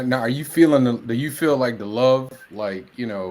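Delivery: ordinary delivery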